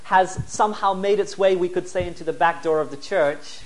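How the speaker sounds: normal talking voice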